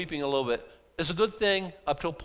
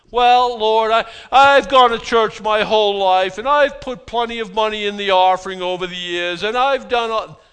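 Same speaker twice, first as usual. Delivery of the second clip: much higher, much louder, puffed-up self-justifying plea; smug churchgoer parody; 5 semitones higher, 9 dB louder than usual. Retold or imitated. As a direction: ham up the pious bragger listing his good deeds. Mocks self-righteous churchgoer's plea.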